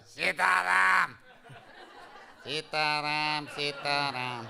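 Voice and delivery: imitated yell, insisting on the plain mantra; Maharaj yelling. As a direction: bellow the mantra from another room. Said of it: "you hear Maharaj yell".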